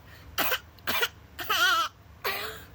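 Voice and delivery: put-on high throaty baby wail rising; high infant cry from the throat. Shown as a demonstration. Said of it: "use, like, your throat for this part".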